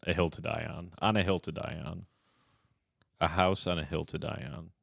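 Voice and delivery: usual speaking voice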